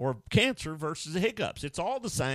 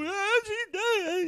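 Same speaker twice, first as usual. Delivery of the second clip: mock mourner; 6 dB louder, much slower than usual. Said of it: "They go".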